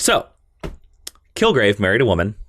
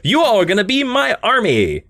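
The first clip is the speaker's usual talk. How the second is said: high, declamatory mock order; acted villain command; 9 semitones higher than usual. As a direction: proclaim it as a villain recruiting followers. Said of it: "And he's like".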